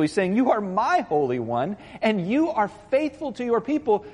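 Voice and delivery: high, driving sermon voice; preaching at full force. Acted. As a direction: preach it with rising urgency. Preaching builds in pitch.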